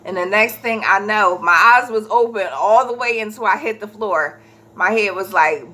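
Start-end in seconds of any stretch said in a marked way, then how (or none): none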